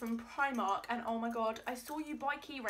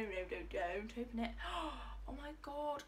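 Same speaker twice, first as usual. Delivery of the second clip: ordinary delivery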